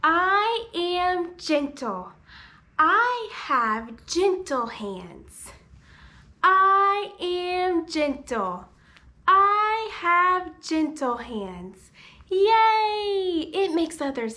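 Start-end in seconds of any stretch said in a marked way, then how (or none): 0.0-11.9 slow, emphatic repeat-after-me chant; modeling for toddlers. Acted. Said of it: "Baby and Macy are gonna say". 12.3-13.5 high, loud, drawn-out yay; cheering. Real, not acted. Cheering the gentle hands.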